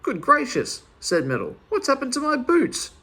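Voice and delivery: amazed at the cheese; astonished. Acted. Finds cheese instead of boots.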